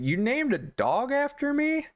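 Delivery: high, incredulous mock question; offended disbelief. Husband learns dog shares his name, incredulous. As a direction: imitate an affronted husband.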